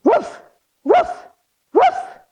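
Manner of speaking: rising playful woofs; kids' yoga dog pose, three playful barks. Pretend. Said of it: "give me three big dog barks".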